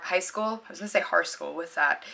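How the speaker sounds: ordinary talk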